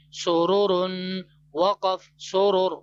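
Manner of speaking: ordinary talk, nothing marked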